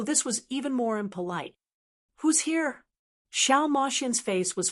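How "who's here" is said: startled. Acted. Startled call at night door.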